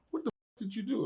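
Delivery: high, hushed alarm; a hint of shocked coworkers whispering. Warner Brothers coworkers asking him, hushed and alarmed. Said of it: "they was like".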